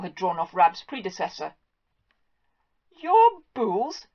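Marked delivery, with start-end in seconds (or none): 3.0-4.1 high, loud astonished echo; gasping. Acted. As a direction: gasp the words out in disbelief.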